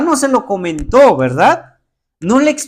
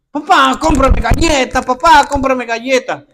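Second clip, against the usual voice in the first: put-on child's pleading whine, a bit louder, said twice; begging child; 4 semitones higher, 4 dB louder than usual. Preacher's son begging his dad for a cookie, whiny. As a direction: nag like a kid wanting a cookie. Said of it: "No me dice".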